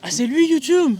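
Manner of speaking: high, puzzled mock question; mock surprise. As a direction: play dumb, amazed. Playing dumb about YouTube.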